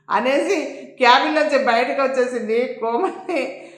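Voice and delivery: lively, projected storytelling voice; story narration. A narrator's reading. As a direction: read the serial out with dramatic emphasis. Narrates an audio story episode.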